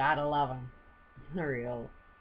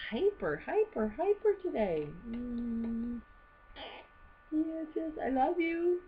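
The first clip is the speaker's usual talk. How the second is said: cooing to the bird; 11 semitones higher, slower than usual. Real, not acted. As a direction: coo at the little bird, much higher. Cooing to her pet bird.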